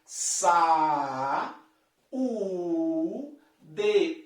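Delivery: sa, u, de said as three beats; syllable by syllable. Shown as a demonstration. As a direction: split sa-u-de into slow separate syllables. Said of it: "Percebeu que essas duas vogais se separam".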